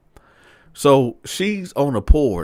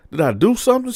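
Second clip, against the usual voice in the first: high, louder innocent question; playing innocent; 12 semitones higher, 5 dB louder, faster than usual. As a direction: protest innocence with the voice shooting up. Man acted, playing innocent.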